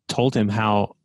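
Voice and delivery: ordinary talk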